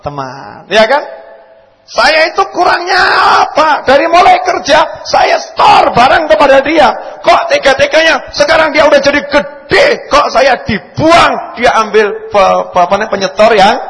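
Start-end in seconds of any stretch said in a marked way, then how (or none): 1.9-13.8 loud, high hurt business partner; betrayed friend shouting complaints; acted grievance. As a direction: yell it out like a betrayed supplier. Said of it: "Ini sama to, teman".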